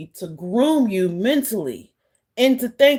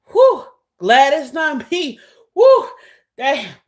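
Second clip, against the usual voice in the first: exaggerated phew, imitating someone relieved; imitated relief; 3 semitones higher, 5 dB louder than usual. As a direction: act out having narrowly got away with it. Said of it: "It was like a".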